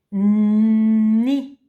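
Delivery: Na said loud and clear; dictation. Shown as a demonstration. Teacher dictating a syllable to the child, loud and clear. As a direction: pronounce Na for dictation. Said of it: "tu écris ni".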